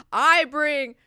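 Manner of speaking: high, loud, hammed-up proclamation; proclaiming preacher. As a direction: announce it like a prophet to a crowd. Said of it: "he's like".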